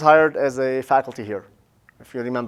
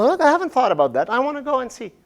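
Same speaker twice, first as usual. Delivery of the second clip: curious listener; 7 semitones higher, faster than usual. Retold or imitated. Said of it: "That's something".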